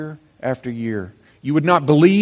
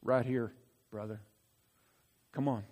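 much softer, lower gentle coaxing; tender invitation; 3 semitones lower, 10 dB quieter than usual. Pretend. Jesus inviting doubting Thomas, soft and tender. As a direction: coax him gently to believe.

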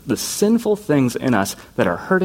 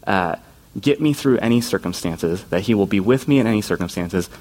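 ordinary talk

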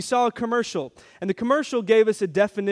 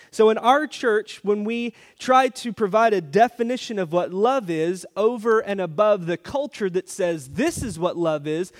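usual speaking voice

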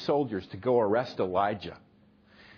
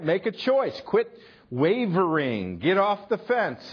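higher, pressing exhortation; urgent appeal; 7 semitones higher than usual. Real, not acted. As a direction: push them to decide now. Preacher urging the congregation.

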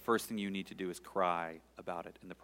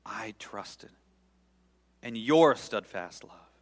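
slow, swelling trust; 2 semitones higher, 9 dB louder, slower than usual. Shown as a demonstration. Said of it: "I'm gonna read it slowly".

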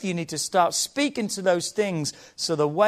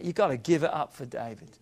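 ordinary delivery

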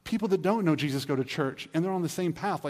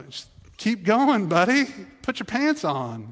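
put-on high, loud, slow coaxing to a small child; coaxing parent; 7 semitones higher, 7 dB louder, slower than usual. Parent coaxing a toddler to keep going, high and slow. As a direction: urge a toddler on like a patient parent.

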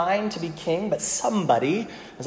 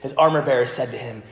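ordinary talk

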